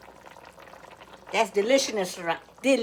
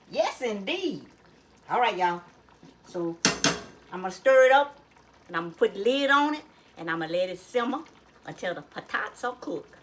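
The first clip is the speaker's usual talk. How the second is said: usual speaking voice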